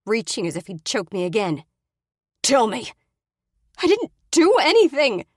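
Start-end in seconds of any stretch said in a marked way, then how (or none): 2.4-2.9 louder and higher order; barked demand. Acted. Brady demanding. 3.8-5.2 high, loud desperate denial; shrill protest. Acted. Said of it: "I protest".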